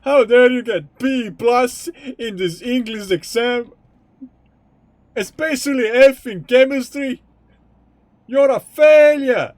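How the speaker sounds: scolding strict dad, building to a high, loud yell; hammed-up furious parent tirade.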